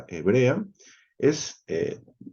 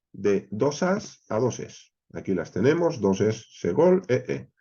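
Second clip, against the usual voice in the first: usual speaking voice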